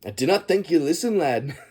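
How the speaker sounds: imitated Scottish accent, breaking into a laugh; Scotty. Fan likening the character to Star Trek, in a Scottish accent. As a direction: do a playful Scottish starship engineer. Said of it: "Scotty from, from Star Trek".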